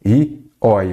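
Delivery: each diphthong "Ei" and "oi" drawn out very slowly; slow dictation of "Ei e oi"; slow spelled-out diphthongs. Shown as a demonstration. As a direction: lay out "Ei e oi" slowly for the class. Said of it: "E aquelas terminadas nos ditongos abertos".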